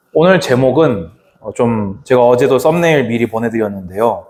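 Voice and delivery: normal talking voice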